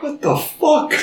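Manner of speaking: high-pitched, hushed-down incredulity; mock disbelief. Viewer reacting to changed face, mock stunned. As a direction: squeak it out in amazement. Re-enacts own shocked reaction.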